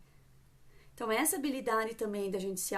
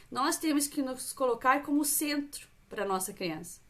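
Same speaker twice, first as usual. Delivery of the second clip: ordinary talk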